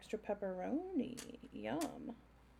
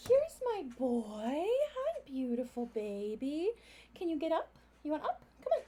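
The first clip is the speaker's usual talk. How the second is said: talking to a dog; 7 semitones higher, 3 dB louder than usual. Real, not acted. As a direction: coo at the dog. Greeting her dog, baby talk.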